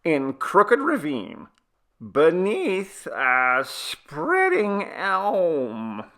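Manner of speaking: put-on high, slow old woman voice; slow granny. A narrator's reading. Grandmother answering slowly.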